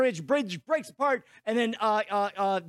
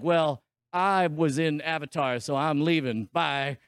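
deep mock voice; 7 semitones lower than usual. Mocking actress leaving franchise.

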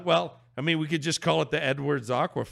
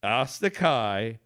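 slow, lower-pitched careful reading; 4 semitones lower than usual. Shown as a demonstration. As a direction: sound the name out slowly. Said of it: "Say it slowly".

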